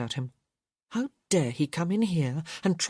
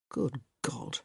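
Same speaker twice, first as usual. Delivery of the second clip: low, much softer muttered oath; 4 semitones lower, 7 dB quieter than usual. A narrator's reading. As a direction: grumble it under your breath. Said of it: "he muttered".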